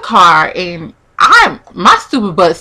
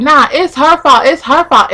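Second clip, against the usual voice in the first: accusing; 6 semitones higher, 3 dB louder than usual. Retold or imitated. Acting out his blaming to others.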